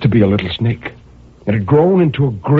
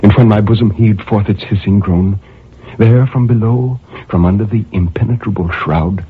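ordinary delivery

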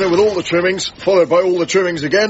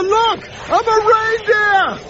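shouting; slower than usual. Acted. Arriving flying, calling out.

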